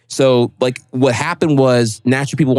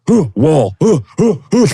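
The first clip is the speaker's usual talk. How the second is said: high, loud dumb caveman grunts; 5 semitones higher, 4 dB louder than usual. Retold or imitated. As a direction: play a clueless Neanderthal. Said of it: "I was like fucking Neanderthal caveman".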